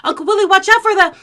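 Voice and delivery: fast, loud, high yell; panicked warning, cut off mid-cry; frantic warning shout. Retold or imitated. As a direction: shout it in a rush before impact. Warning as web gets closer.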